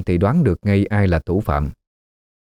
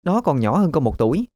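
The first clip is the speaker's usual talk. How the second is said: put-on higher, fast, sneering young woman; scornful daughter; 7 semitones higher, faster than usual. A narrator's reading. Daughter refusing her mother, higher, scornful whine. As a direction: whine it back at mom with contempt. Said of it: "Nga hứ một tiếng".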